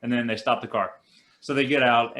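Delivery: ordinary talk, nothing marked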